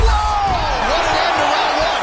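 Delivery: high, loud, thrilled call; excited shout. Real, not acted. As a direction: shout it out, amazed. Round ends on a knockdown.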